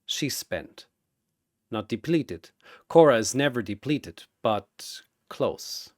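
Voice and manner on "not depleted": ordinary delivery, nothing marked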